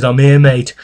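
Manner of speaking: loud, blunt retold bark from a bullying boss; bullying manager loudly getting in his face; mock loud challenge. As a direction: throw it at him like a confrontation. Bullying manager confronting him.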